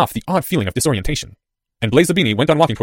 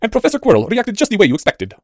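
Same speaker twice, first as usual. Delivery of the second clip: put-on higher-pitched, lilting boy's voice; boy informant; 10 semitones higher than usual. A narrator's reading. Boy reports to his cloaked handler, higher voice.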